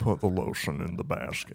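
low, quiet creepy villain voice; creepy villain impression, kept quiet; Buffalo Bill. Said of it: "do your Buffalo Bill impression".

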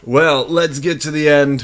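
ordinary talk, nothing marked

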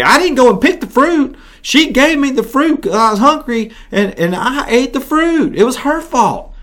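high, whining Adam passing the blame; whiny excuse. Acted. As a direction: plead like a guilty man dodging blame. Adam blaming Eve.

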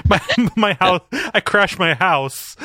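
high-pitched, drawn-out mock distress, laughing. Joking to friends about crashing the house, laughing. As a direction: laugh helplessly through the words.

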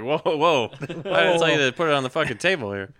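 high, loud, hurried backpedalling; alarmed protest. Retold or imitated. Defending himself to the shop manager, loud alarmed protest. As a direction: protest fast, refusing the blame. Retold protest at being blamed.

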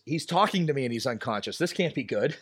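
normal talking voice

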